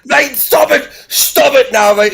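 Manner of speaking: loud half-joking reprimand; loud telling-off. Real, not acted. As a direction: shut the heckler down firmly. Telling off the heckler.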